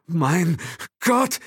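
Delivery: high, loud halting gasp; stammering. A narrator's reading. Will stammers in shock, high and loud. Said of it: "stammelte Will".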